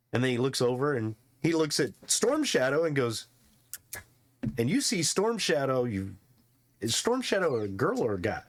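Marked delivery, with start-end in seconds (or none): none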